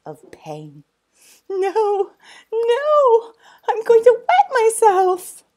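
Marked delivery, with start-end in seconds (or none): 1.5-5.4 high, loud squealing plea; desperate tickled boy. Acted. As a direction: beg between giggles.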